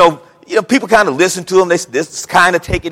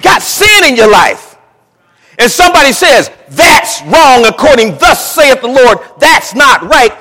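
preaching shout; 4 semitones higher, 5 dB louder than usual. Real, not acted. Preacher thunders thus saith the Lord.

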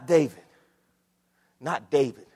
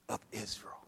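voiceless whisper, much softer; hushed emphasis; 8 semitones lower, 16 dB quieter than usual. Acted. Preacher to congregation, whispered emphasis. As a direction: breathe it out like a secret.

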